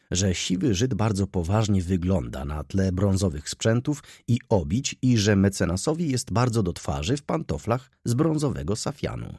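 plain reading voice

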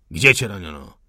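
officer's loud demand; harsh interrogation. Acted. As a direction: bark it down at the prisoner. Officer interrogates wounded man.

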